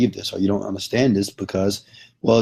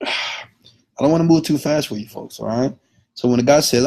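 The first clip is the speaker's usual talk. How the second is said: normal talking voice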